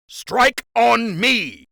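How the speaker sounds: high, loud, drawn-out rallying shout; battle cry. Acted. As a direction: call the charge to the soldiers, slow and ringing. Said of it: "he cried out".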